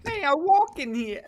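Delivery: put-on high-pitched New York accent line. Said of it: "New York accent mixed with uwu".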